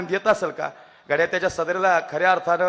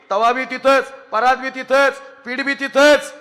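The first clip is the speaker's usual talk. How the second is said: building excitement; 5 semitones higher, 3 dB louder than usual. Acted. Daughter drops everything for father.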